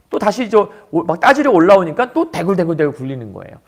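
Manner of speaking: normal talking voice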